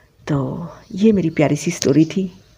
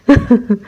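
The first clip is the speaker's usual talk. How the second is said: short, loud real chuckle; light amused laugh; 5 semitones higher, 5 dB louder than usual. Real, not acted. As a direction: laugh it off quickly. Laughs again after the reply.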